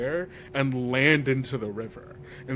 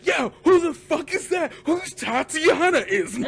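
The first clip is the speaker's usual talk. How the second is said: high shouted outrage, half laughing; shouting players; 14 semitones higher, 4 dB louder than usual. Retold or imitated. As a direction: yell it at the table, laughing in disbelief. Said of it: "all of them see".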